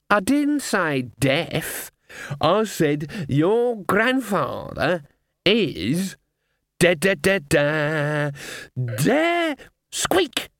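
slow drumroll ending on a squeak; dramatic raven. Acted. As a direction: drag out the drumroll, then squeak.

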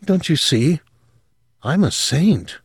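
lightly voiced young woman, high and earnest; confessing her strange family with rising fervour; a hint of a girl gone dreamy. Sylvia voiced in story.

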